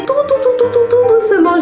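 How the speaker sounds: climbing up high and tense; rising squeak. Acted. Describing needle slowly piercing.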